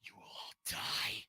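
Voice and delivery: low, slow villainous threat; slow, ominous villain's promise; menacing threat. Acted. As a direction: draw out a deadly warning, pitched well down. Villain turns and threatens everyone.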